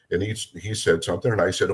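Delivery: normal talking voice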